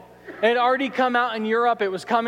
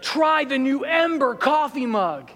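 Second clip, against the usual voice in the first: higher, slow hammed-up ad announcer; infomercial pitchman; 6 semitones higher than usual. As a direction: pitch the mug like a cheesy TV ad. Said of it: "then he's like".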